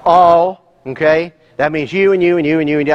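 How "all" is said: "All" hit loud and alone; one loud word; stressed ALL. Shown as a demonstration. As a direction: punch "All" like a verdict. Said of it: "by the word all".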